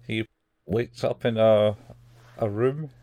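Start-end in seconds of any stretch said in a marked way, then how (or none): none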